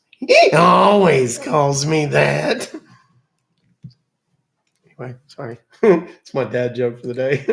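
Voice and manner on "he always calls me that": loud, slow punchline, laughing; punchline through laughter. Acted. Dad telling his joke, cracking up at the punchline. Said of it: "And he goes".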